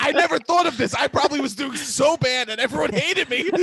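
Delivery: high, loud rush of dawning horror; playful panic at a revelation; mock-horrified realisation.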